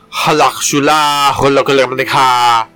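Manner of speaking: mock Arabic. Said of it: "walk around the store going".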